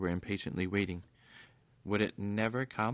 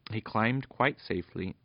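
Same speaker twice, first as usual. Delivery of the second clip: ordinary delivery, nothing marked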